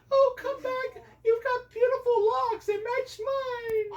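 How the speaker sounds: squeaky princess call. Acted. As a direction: squeal after him, slowing at end. Princess calls fleeing prince.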